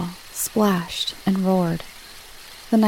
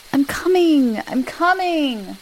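higher, drawn-out reply, slower on the repeat; exasperated calling back; 8 semitones higher than usual. A narrator's reading. Boy told to hurry up, exasperated calling back. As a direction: stretch it out as you call ahead. Levi calls back to Kingsley.

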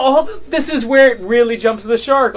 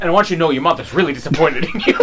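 fast mock scolding; 4 semitones lower, much faster than usual. Mock quote to Bond.

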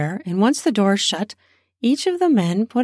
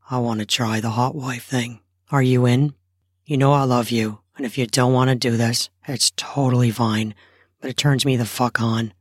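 low, earnest male voice; husband voice; 8 semitones lower than usual. Acted. Husband asks wife.

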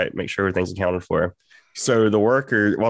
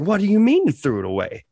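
high, loud, incredulous question; imitated indignant disbelief; 10 semitones higher, 3 dB louder than usual. Worker confronting coworker who binned his vape, imitated indignant disbelief. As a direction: demand it in rising outrage. Repeats his shocked reaction.

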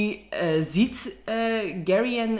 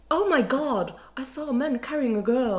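posh British English accent, higher; 7 semitones higher than usual. Shown as a demonstration. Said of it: "Probeer eens".

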